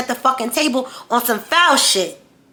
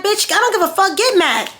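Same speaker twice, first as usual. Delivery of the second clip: inner angry defiance; 8 semitones higher than usual. Retold or imitated. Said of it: "I'm like".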